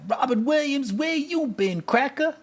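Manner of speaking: teasing the movie's character in a hammed-up voice; mock Chris Rock voice. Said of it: "He's playing like Chris Rock".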